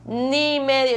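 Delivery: "Ni medio" más agudo y fuerte; énfasis en ni medio. Real, not acted. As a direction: remarca "Ni medio" con fuerza. Repite con énfasis su pobreza. (recalca "Ni medio" subiendo la voz)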